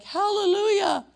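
high, loud, drawn-out cheer; mock hallelujah shout. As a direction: whoop it like an overexcited congregation. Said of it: "all the, you know, kill cancer people are like".

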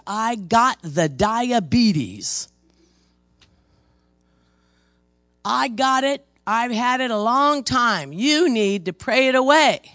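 hammed-up demanding lady; mock demand. Lady at the altar insisting she has diabetes. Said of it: "she started screaming".

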